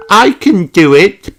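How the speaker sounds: put-on high, loud determined girl voice; little girl psyching herself up; Peppa. A narrator's reading. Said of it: "Peppa nods".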